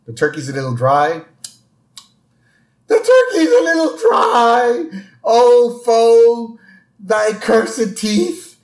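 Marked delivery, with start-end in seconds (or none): 2.9-4.8 high crying wail, overdone; mock wailing. Dramatic wail over dry turkey. 5.2-8.6 high, loud, slow tragic lament; melodramatic Shakespearean. Acted.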